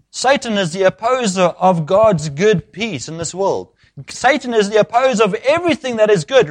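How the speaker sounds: high-pitched, insistent sermon build; impassioned preaching. Acted. Preacher declaring Satan opposes all good, impassioned preaching. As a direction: hammer the repeated words to the congregation.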